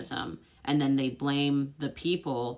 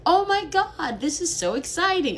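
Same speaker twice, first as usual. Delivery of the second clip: much higher and louder mock gushing; fake American excitement; 14 semitones higher, 6 dB louder than usual. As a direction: ham up over-the-top enthusiasm. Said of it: "Americans are like".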